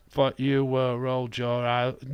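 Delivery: ordinary talk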